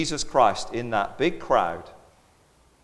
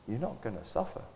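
gentle reassurance; 10 dB quieter, faster than usual. Retold or imitated. Jesus comforting widow softly.

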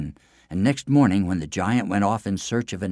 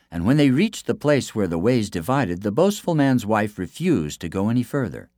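plain reading voice

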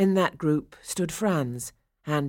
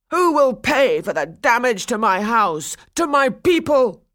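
put-on loud, higher indignant old man; angry demand; 8 semitones higher, 8 dB louder than usual. A narrator's reading. Toymaker storming into the wedding, loud and angry. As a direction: demand payment in outrage. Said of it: "he cried".

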